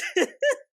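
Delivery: very high squeal of a laugh; squeaky laugh. Real, not acted. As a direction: burst into a rising giggle. Overwhelmed laugh.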